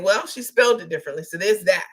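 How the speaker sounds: ordinary delivery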